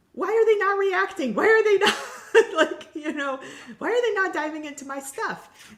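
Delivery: high, loud exasperated wail breaking into a laugh; hammed-up outrage at being ignored; frantic disbelief. Stranded guest wondering why staff won't react, high loud mock wail. Retelling her own frantic thoughts.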